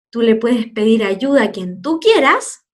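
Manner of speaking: normal talking voice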